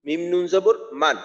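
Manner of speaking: usual speaking voice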